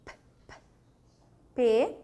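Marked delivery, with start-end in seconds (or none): 0.0-0.5 P, P as voiceless bursts with no vowel; teacher isolating P; isolated P sound. Shown as a demonstration. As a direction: pop out the bare P sound twice. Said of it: "O primeiro som".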